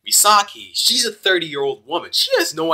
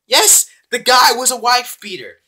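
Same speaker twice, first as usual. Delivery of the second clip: indignant exclaim; 9 semitones higher, 7 dB louder than usual. Real, not acted. Outraged repeat of wife beater.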